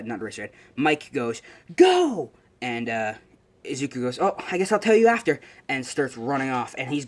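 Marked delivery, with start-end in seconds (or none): 1.8-2.3 very high, loud drawn-out yell; high-pitched announcer's holler; starting shout. Acted. Retold announcer starts the test. 4.2-5.4 higher, louder, hurried-off reply; acted startled afterthought; caught off guard. Retold character hurries off at start.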